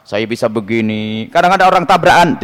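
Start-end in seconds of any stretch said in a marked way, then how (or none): 0.0-1.3 low, slow, drawn-out boast; mock bragging. Preacher imitates minor kiai claiming miracles, mock bragging. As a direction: imitate a self-important minor cleric. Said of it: "kiai kecil misalnya ngaku-ngaku".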